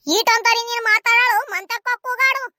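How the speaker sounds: loud, cross rebuke; scolding. Acted. Quarrel, telling her off.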